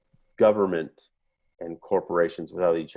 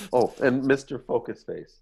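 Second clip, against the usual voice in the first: usual speaking voice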